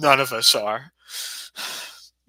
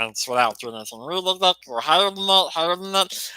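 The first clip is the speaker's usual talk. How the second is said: voice like Killian; 8 semitones higher than usual. Shown as a demonstration. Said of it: "I'm gonna make my voice sound like Killian".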